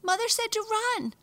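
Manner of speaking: high, fast, loud squeak; squeaky. A narrator's reading. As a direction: squeak it out in alarm. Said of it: "they squeaked".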